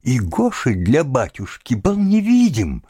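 usual speaking voice

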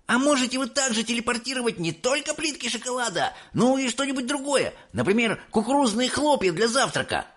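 put-on high, fast pestering kid; excited boy. A narrator's reading. Mike pestering Wonka with questions, excited. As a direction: rattle off the question eagerly. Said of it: "был возбуждён".

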